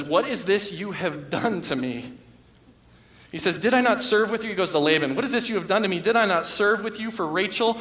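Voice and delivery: enraged. Retold or imitated. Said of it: "And Jacob's enraged".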